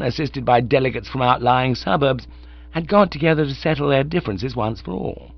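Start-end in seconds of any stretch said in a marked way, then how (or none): none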